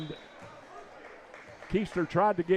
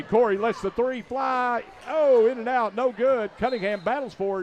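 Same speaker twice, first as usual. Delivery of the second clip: excited; 6 semitones higher than usual. Real, not acted. Missed three, big call.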